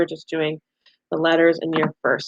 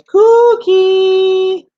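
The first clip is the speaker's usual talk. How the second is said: much higher, louder drawn-out cry; kooky frog call; 11 semitones higher, 8 dB louder than usual. Retold or imitated. Teacher reading to kids, calling out high and loud like a kooky frog. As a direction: sing it out high and long like a silly frog. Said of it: "a kooky frog says".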